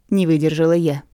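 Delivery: ordinary delivery, nothing marked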